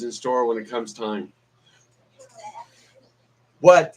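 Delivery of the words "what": sudden loud startled what; real loud surprise. Real, not acted. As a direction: jump at something off camera. Sudden reaction off camera.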